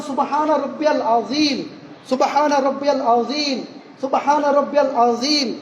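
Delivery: slow, measured tasbih; slow recitation. Shown as a demonstration. Preacher demonstrating the ruku tasbih slowly. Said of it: "তিনবার বলেন".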